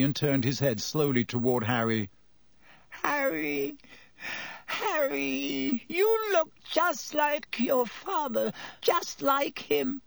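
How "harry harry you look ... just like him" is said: slow, high, wheedling whimper; trembling plea. A narrator's reading. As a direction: draw it out in a high, fearful voice. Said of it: "trembling uncontrollably".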